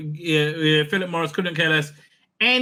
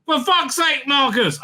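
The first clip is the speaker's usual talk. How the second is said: mock outraged outburst; 10 semitones higher than usual. Reading out an angry tweet.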